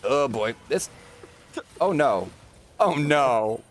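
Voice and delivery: drawn-out, rising cries of dismay; building dismay. Real, not acted. Caster watching a good play collapse, mounting dismay.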